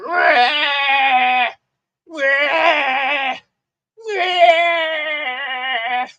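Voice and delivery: long, high, loud wailing battle cry; mock angry scream. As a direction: screech like a wackadoodle swinging a lightsaber. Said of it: "just screaming, getting angry, holding a lightsaber".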